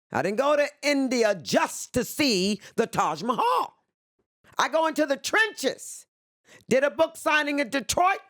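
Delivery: high, ringing and proud; proud declaration. Real, not acted. Telling the interviewer where she went, rising high and proud. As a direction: proclaim it like a rallying cry.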